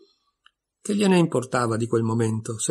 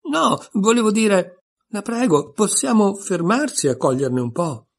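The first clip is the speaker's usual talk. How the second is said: high, slow pleading; 7 semitones higher, 4 dB louder than usual. A narrator's reading. Alice begs the sheep to stop the boat, pleading high. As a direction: beg her sweetly to stop. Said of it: "implorò Alice".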